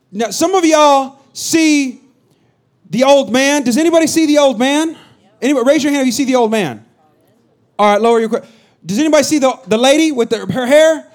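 rally the crowd to raise hands; excited host. Real, not acted. Pastor quizzing the crowd on a picture, lively.

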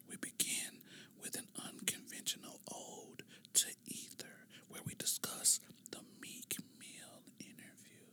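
hushed whisper fading almost to nothing; ASMR whisper. Shown as a demonstration. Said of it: "sometimes you can whisper".

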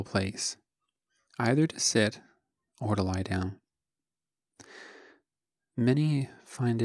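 soft, unhurried meditation voice with long pauses; calm, hushed instruction, phrase by phrase; guided meditation. Acted. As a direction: guide listeners gently through a body scan, leaving space after each phrase. Leads a guided body scan.

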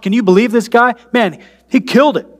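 casual amazed onlooker, lightly hammed-up; impressed crowd voice. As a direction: play the gushing crowd, half-joking.

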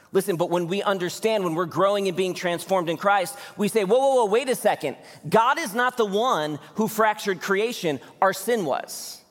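fired-up preacher; preaching at full force. Acted. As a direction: preach it out with rising fervor.

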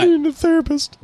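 high, put-on voice of a man at his wits' end; mock despair. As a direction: play up being driven mad. Mock despair after laughing.

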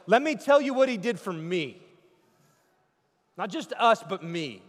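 loud, high declaration; personal testimony. Acted. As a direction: proclaim it as your own story. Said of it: "He's like".

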